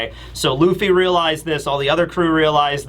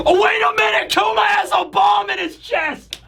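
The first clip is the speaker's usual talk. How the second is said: real hype. Real, not acted. Fan realizing Kuma's bomb will nuke Egghead, excited yell.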